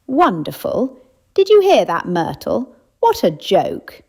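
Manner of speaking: a loud, high mocking jeer; scoffing loudly. Elderly lady mocking the mayor's eulogy, loud scoffing. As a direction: scoff at the praise, jumping way up. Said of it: "scoffed loudly".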